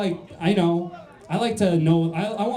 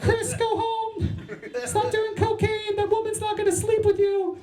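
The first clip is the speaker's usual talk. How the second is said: chirping birds. Acted. Birds scolding a man doing blow at dawn, high chirping bird voice. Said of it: "They're like".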